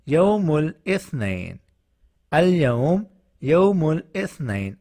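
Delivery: یوم الاثنین said slowly, each word apart; slow model sentence. Shown as a demonstration. Teacher modelling the Monday sentence slowly for learners. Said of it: "تو اس کا جملہ کیا ہوگا".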